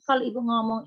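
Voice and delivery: usual speaking voice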